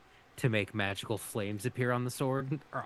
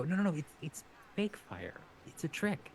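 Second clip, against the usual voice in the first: higher-pitched, flustered backpedalling; hasty reassurance; 8 semitones higher than usual. Acted. Vala to upset kid over magic flames, anxiously reassuring. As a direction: voice lifts as you try to undo the upset. Player hastily reassures a frightened child.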